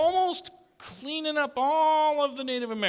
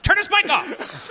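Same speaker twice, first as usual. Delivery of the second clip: raised, insistent repeat; louder insistent command; 8 semitones higher than usual. Real, not acted. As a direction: say it again, pushing harder. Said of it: "repeated louder, higher".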